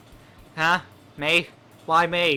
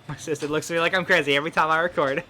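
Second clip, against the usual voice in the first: normal talking voice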